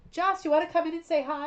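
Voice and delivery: loud, high call to another room, fast. Real, not acted. Host calling to Joss to come say hi, loud and high.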